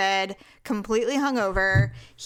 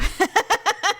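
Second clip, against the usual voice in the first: burst of laughter; 11 semitones higher than usual. Real, not acted.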